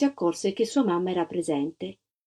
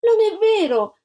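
put-on high, loud child's protest; indignant cry; 12 semitones higher, 5 dB louder than usual. A narrator's reading. Antonella denying the other girl's accusation, indignant cry. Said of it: "gridò Antonella".

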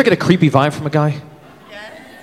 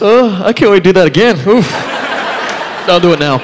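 groggy longing; 5 semitones higher than usual. Retold or imitated. Guy waking up longing for sleep, mock groan.

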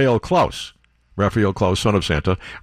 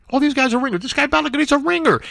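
high-pitched outrage; faster than usual. Acted. Host ranting to listeners that the players are ringers, in a much higher, outraged voice. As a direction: voice jumps way up in mock indignation.

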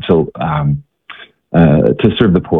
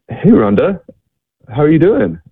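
put-on bright, higher hello to an old friend; John's friendly greeting; 8 semitones higher than usual. John greets Rhonda on the street, upbeat and higher. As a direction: call her name warmly and cheerfully. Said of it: "John walks up to her and he says".

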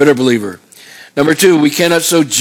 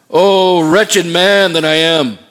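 slow, raised outcry quoting Paul; higher, drawn-out anguished quotation; crying out; 3 semitones higher, much slower than usual. Retold or imitated. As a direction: draw out the lament like Paul crying out. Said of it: "Paul just cries out and says".

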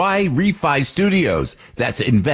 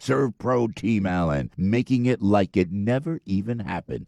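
upbeat, steady commercial read; 5 dB quieter than usual. Acted. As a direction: sell it like a radio spot. Host reads sponsor ad.

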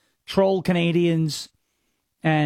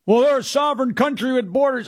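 mock commenter; 6 semitones higher than usual. Mocking Canadian commenter.

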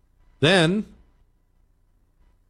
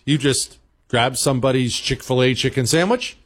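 ordinary talk, nothing marked

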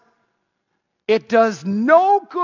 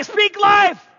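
loud, high rallying shout; 13 semitones higher, 5 dB louder than usual. Acted. Preacher shouting a call for the crowd to echo, loud.

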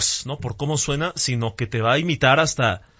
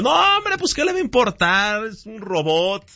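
high excited retort; 7 semitones higher than usual. Retold or imitated. Retells reassuring his father.

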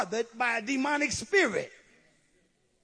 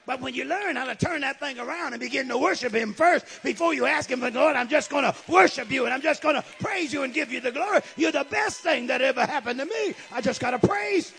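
raised, driving praise; fervent preaching; 5 semitones higher, 7 dB louder than usual. Real, not acted. Preacher praising the Lord before asking, fervent. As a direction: preach it forcefully, voice lifted in praise.

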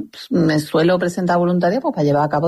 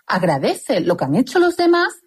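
réplica indignada; 7 semitones higher than usual. Retold or imitated. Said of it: "Y tú dices".

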